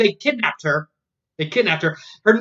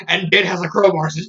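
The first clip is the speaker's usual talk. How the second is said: ordinary talk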